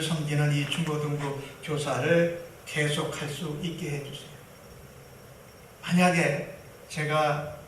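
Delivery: the son prays quietly, pleading to keep teaching; earnest quiet prayer. Retold or imitated. Young man praying softly and earnestly. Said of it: "라고 기도했어요".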